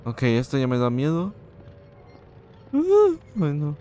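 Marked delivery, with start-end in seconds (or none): 2.7-3.8 sudden high yelp of fright; jolted by a jump scare, yelping; startled scream. Real, not acted. Scared streamer yelps at the game.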